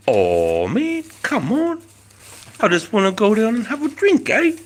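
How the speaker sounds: hammed-up New Zealand bloke voice; Kiwi accent. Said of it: "Do you Kiwi accent?".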